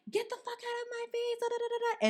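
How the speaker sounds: very high, squeaky imitation of a tantrum; high mock tirade tailing into nonsense syllables; mock angry. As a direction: mimic her ranting in a tiny high voice. Mimicking angry cousin through the door.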